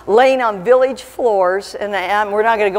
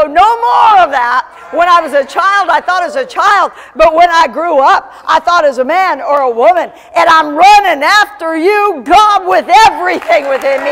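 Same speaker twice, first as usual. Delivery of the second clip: loud, raised, impassioned proclamation; full-force preaching; 6 semitones higher, 7 dB louder than usual. Real, not acted. Preacher vowing to run after God, impassioned full-force preaching. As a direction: cry out the resolve with rhythmic force.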